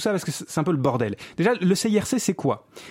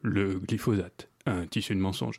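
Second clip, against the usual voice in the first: robot voice; 8 semitones lower, 7 dB quieter than usual. Shown as a demonstration. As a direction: say it like a speech synthesizer. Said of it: "vraiment avec la voix robot". (voix de robot lente et grave)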